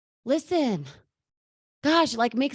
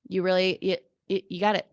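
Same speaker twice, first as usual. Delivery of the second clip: ordinary talk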